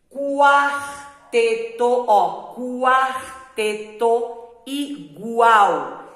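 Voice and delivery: quarteto and igual drawn out slowly with the U clearly voiced; slow sounding out. Shown as a demonstration. Teacher sounding out words for pupils, very slow. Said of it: "O Q U e o G U".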